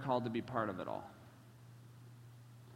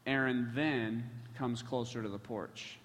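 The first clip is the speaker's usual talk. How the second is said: normal talking voice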